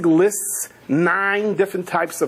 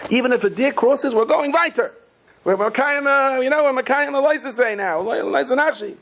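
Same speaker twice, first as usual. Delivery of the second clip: high, fervent pep talk; rousing defiance; 10 semitones higher than usual. Retold or imitated. Father to kids in the car, rousing defiance. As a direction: declare it like a rallying cry.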